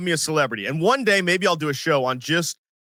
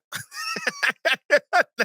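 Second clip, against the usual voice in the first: burst of laughter. Real, not acted. Laughing at his own story.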